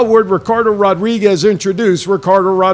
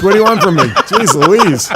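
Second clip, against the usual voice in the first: laughing. Real, not acted. Laughing at own failed intro.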